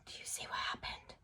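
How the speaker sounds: conspiratorial whisper. Acted. Teacher whispers to students about a spelling mistake. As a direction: whisper the question like a shared secret.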